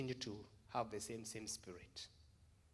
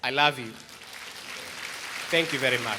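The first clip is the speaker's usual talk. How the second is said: much louder, calling out love and thanks; loud heartfelt thanks; 2 semitones higher, 15 dB louder than usual. Real, not acted. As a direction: shout it warmly to the whole room. Farewell shout to the congregation.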